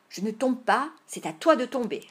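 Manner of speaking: indignant playground squabble; arguing back. Acted. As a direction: throw the demand right back. Boys quarrel over falling.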